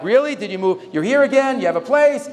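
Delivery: mock surprise. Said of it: "people are like".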